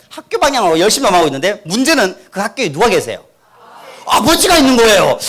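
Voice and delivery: usual speaking voice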